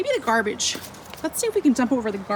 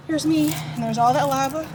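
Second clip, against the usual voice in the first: usual speaking voice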